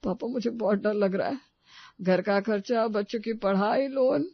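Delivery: worried son listing troubles, pitch rising at the end; acted fearful distress. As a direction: pour out the fears to father. Distraught son confides his fear. (डरा हुआ, परेशान बेटा)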